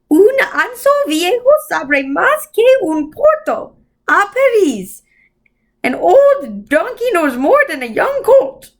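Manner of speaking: high, slow otherworldly voice; eerie Mrs Who. A narrator's reading. Strange old being quoting Dante to Mrs Whatsit, high slow eerie voice. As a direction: drift the words like an old spirit.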